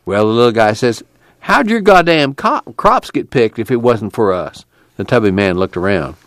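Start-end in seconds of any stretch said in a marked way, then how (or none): 1.4-4.6 mildly indignant, starting high then settling; indignant retort. Acted. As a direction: retort with a touch of outrage. Little guy retorts to deputy.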